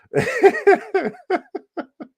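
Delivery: long, high-pitched burst of laughter; high giggle. Real, not acted. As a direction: giggle helplessly, high and loud. Laughing at own offensive impression idea.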